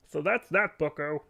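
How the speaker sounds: a lighter hint of the Jordan Peterson voice; smug Canadian sign-off, lightly; Jordan Peterson impression. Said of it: "It's a Jordan Peterson Canadian accent".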